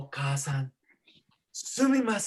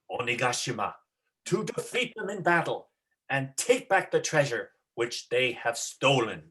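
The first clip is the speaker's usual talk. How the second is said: lower, proud samurai voice; heroic declaration; 6 semitones lower than usual. Acted. Momotaro proclaims his quest to the monkey, low and proud. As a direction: proclaim it boldly.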